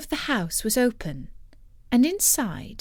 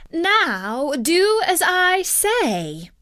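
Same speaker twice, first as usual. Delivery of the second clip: high, slow, loud commanding witch; witch's cry; 9 semitones higher, 4 dB louder, much slower than usual. A narrator's reading. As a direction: draw out the order with menace. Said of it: "cried Circe".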